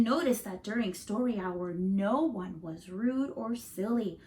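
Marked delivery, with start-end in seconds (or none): none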